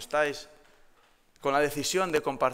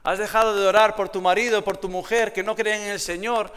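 rising sermon appeal, louder; preaching; 7 semitones higher, 7 dB louder than usual. Acted. Pastor challenging the church to pray, building intensity. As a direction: press the congregation with questions.

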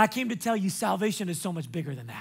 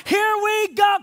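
high, louder announcer tease; host teaser; 11 semitones higher, 6 dB louder than usual. Retold or imitated. Host teasing before the commercial, high and hyped. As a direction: build the suspense like a host. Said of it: "And they're like".

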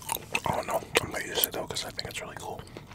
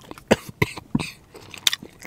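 real sputtering cough mid-sentence; harsh choking cough fit; 6 dB louder than usual. Real, not acted. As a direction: cough hard like food went down wrong. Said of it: "choking on".

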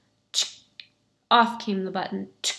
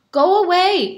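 loud, higher "go away"; shouted refusal; 9 semitones higher, 8 dB louder than usual. Acted. Villager shouting through the door at beggar, loud. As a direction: bark him off the doorstep.

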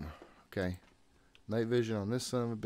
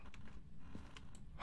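startled gasp; 12 dB quieter than usual. Real, not acted. Ghost hunt, startled.

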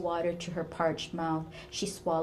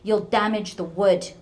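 angry snap; 3 semitones higher, 10 dB louder than usual. Acted. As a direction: bark it at her daughter like an old tyrant. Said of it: "her mother snapped".

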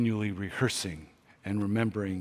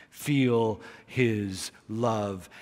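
slow tender plea; much slower than usual. Real, not acted. Intimate appeal in sermon.

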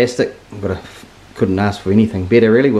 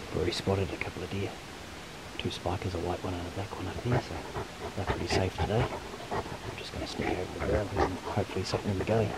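hushed hunting voice; 15 dB quieter than usual. Real, not acted. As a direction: keep it down, stalking prey. Sneaking up on deer.

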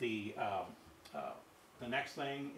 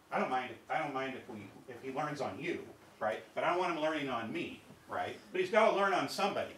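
protesting patient; 5 semitones higher, 9 dB louder than usual. Acted. Voicing a protesting patient's argument.

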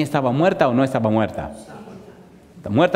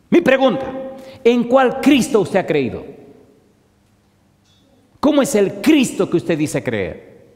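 preaching challenge; 8 semitones higher than usual. Real, not acted. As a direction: fire the question at the congregation.

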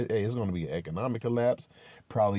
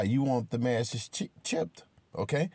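ordinary talk, nothing marked

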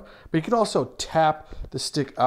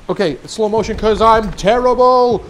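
loud, higher, hammed-up announcement; mock heroic self-mockery; 5 semitones higher, 8 dB louder than usual. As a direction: proclaim your own uselessness grandly. Self-mocking while starting slow motion.

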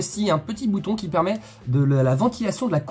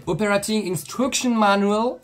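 drawn-out, higher, put-on English accent; showy English accent; 5 semitones higher, slower than usual. Shown as a demonstration. Host reading the manual's title to viewers, showy English accent. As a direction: show off an English accent, slowly. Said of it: "avec mon accent anglais qui détonne".

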